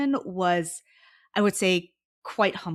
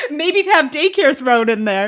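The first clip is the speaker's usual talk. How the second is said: high laugh, then words still laughing and louder; 7 semitones higher, 12 dB louder than usual. Real, not acted. Guest adds a joking wish, laughing as she talks. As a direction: giggle the joke through.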